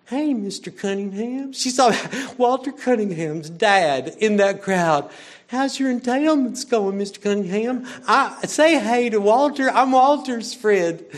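put-on high, chirpy little-girl voice; voicing Scout. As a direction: play the innocent child greeting a neighbor. Voicing child Scout in the story.

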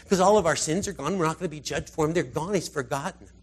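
ordinary delivery

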